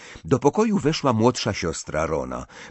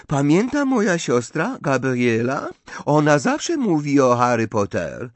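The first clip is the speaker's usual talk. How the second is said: put-on high, louder, effusive French-accented voice; Fleur, gushing foreigner; 7 semitones higher, 4 dB louder than usual. A narrator's reading. Fleur tells Harry about her sister, gushing with French accent. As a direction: gush in broken, lilting foreign speech.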